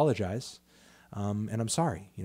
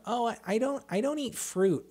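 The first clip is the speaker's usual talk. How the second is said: high-pitched picky eater; mock whining; 11 semitones higher than usual. Mimicking people who gripe about food, mock whiny. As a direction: whine like a fussy child, much higher. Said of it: "listening to somebody bitch about".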